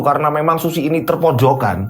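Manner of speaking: plain reading voice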